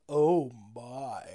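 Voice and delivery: very low, drawn-out swoon; lecherous tire. Retold or imitated. Tire sees this girl and follows her, lecherous. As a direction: drop way down, ogling with interest.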